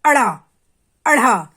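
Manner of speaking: quick, emphatic flap on अड़ा and अढ़ा; quick bouncing sounds. Shown as a demonstration. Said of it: "फिर ऊपर आ गई है जल्दी से".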